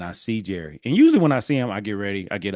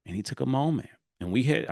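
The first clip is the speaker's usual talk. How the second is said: ordinary talk, nothing marked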